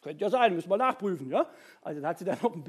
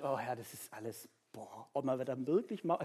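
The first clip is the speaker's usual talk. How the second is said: weary sigh; 7 semitones lower, 7 dB quieter than usual. Acted. Voicing weltschmerz before the TV news.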